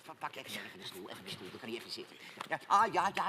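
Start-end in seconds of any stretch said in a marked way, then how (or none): 0.1-2.2 soothing. Acted. Calming a distressed friend.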